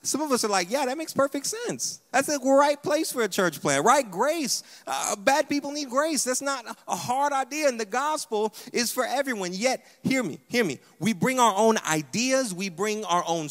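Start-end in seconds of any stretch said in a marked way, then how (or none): 0.7-9.5 higher-pitched voice of an eager believer; keen, high-pitched enthusiasm; eager agreement. Acted. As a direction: act out someone who is all for it. Acting out eager church planters.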